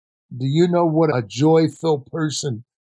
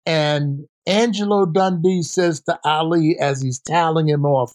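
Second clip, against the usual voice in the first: normal talking voice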